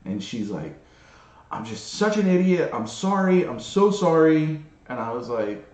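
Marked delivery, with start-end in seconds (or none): 1.5-4.6 higher-pitched imitation of a distraught apology; upset apology. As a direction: act out someone upset, voice lifted. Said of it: "she is visibly upset".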